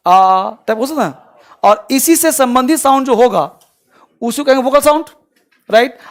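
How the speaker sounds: usual speaking voice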